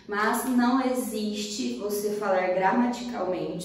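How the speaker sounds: ordinary talk, nothing marked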